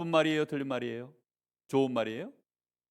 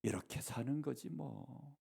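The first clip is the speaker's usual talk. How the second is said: much softer, slow, resigned sigh of a line; lonely voice; 4 semitones lower, 14 dB quieter, slower than usual. Retold or imitated. Husband answering his wife, softly and lonely. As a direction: let it fade out lonely and slow. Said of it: "쓸쓸한 목소리로".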